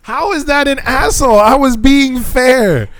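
very high, drawn-out comic objection; high-pitched playful outrage; indignant protest. Real, not acted. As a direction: squeal your defence, stretching the words. Protests at being called an asshole.

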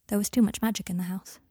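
ordinary delivery

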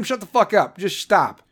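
ordinary delivery

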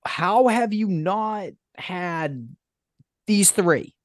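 slow incredulous question. Retold or imitated. Grilling a friend about untried sandwiches, in slow high disbelief. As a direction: stretch it out as if it is unthinkable. Berating friend over his order.

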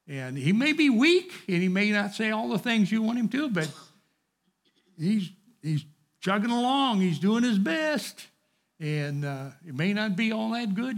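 rally them behind Nicodemus; fervent preaching. Real, not acted. Preacher urging his class to back Nicodemus.

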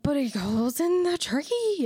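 put-on high, slow drunk whine; drunk person voice. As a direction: sound like a tipsy neighbor. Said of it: "your drunk person voice".

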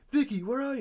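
high, loud searching cry; mock frantic calling. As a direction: call out in a hammed-up panic. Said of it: "freaking out and he can hear".

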